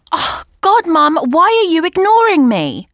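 high, slow whining protest; whiny complaint.

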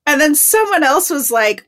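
ordinary delivery, nothing marked

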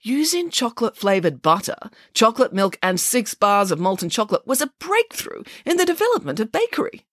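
lightly voiced earnest pig; Nanny Piggins, arguing. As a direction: proclaim it with rising conviction.

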